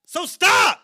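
loud, high and fired up; heated outburst. Real, not acted. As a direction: call them out hard, voice rising. Heated podcast rant calling out.

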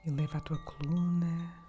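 calm guide with long pauses between breaths; guided meditation. Acted. (meditação guiada, voz suave e lenta)

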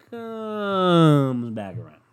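COMES stretched very long, swelling into "back around"; drawn-out emphasis. Shown as a demonstration. Likening the podcast to Halley's Comet, "comes" hugely drawn out. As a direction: hold "comes" like a slow orbit.

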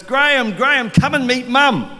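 high, loud eager calling out; excited girl. Retold or imitated. Youth group girl calling Graham over to meet Mum, loud and eager. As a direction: call out like a thrilled teenager spotting a friend. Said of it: "She goes".

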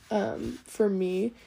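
ordinary talk

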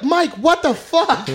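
aghast disbelief; high, drawn-out mock shock; friends shocked. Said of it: "And they're like".

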